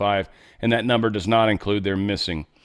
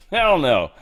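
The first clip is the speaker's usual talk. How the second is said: mocking laughing refusal; 7 semitones higher, 4 dB louder, slower than usual. Shown as a demonstration. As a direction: laugh loudly through a scornful no. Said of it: "That's how I would have said it".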